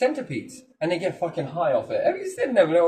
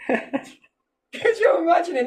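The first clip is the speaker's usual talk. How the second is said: slow, high, laughing question; 12 semitones higher, 3 dB louder, much slower than usual. Real, not acted. Speaker laughing while asking the host to imagine. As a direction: laugh through the words.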